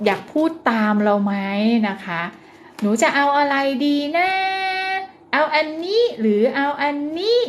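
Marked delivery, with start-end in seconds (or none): 2.5-7.4 put-on slowed down for a child. Shown as a demonstration. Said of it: "เราก็จะช้าลงนิดนึงใช่ไหม".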